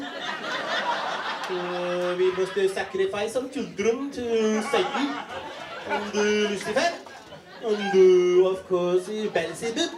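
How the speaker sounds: sing-song cheerful evil plans; jolly satanist. Retold or imitated. Comic voicing a Norwegian metalhead, cheery and mock jolly.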